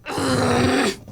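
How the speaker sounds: loud exasperated sigh; heavy sigh. Acted. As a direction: breathe out the last of your patience. Before outburst.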